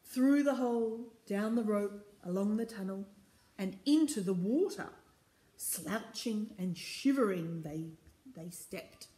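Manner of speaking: rhythmic, emphatic storybook reading; story narration. A narrator's reading. Reader takes the crew down the rope, story narration. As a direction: chant the refrain clearly for little listeners.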